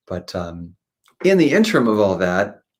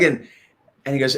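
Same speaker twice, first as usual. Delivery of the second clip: ordinary talk, nothing marked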